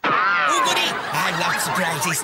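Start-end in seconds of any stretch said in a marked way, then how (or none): 0.0-0.9 high, loud squeal of delight. Acted. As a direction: squeal with childish glee.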